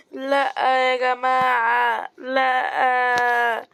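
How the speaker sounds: slow wailing no, stretched out; whiny crying. Real, not acted. Gamer wails to his viewers after dying. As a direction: draw out the no like a sob.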